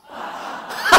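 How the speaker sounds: loud, high burst of laughter; real uncontrollable laugh. Real, not acted. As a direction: crack up helplessly. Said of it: "it just cracks me up".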